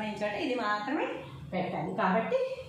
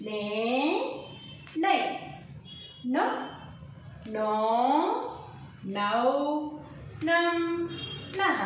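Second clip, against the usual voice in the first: slow syllable chant with pauses; recitation; 3 semitones higher, slower than usual. Acted. Teacher leading students through the syllable table, chanting. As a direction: read each syllable aloud for the class to repeat. Teacher reads gunintham aloud together.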